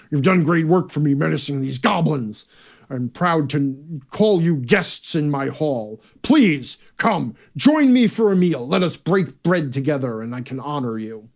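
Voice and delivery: stately warlord welcoming his guests; lord in his hall. Shown as a demonstration. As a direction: hold court, inviting heroes to the table. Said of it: "the lord in his hall".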